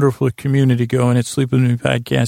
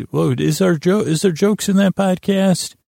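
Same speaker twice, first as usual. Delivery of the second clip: higher-pitched mock bafflement; puzzled listener; 6 semitones higher than usual. Imagined listener reacting to the podcast, mock puzzlement.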